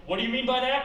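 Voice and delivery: high, loud acted retort; affronted challenge. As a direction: fire back as an alarmed agent. Agent snaps back at sarcasm.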